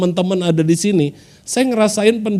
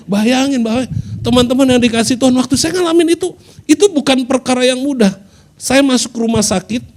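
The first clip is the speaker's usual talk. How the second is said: raised, urgent preaching voice; 6 semitones higher than usual. Acted. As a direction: lift the voice and press the point. Preaching at full force.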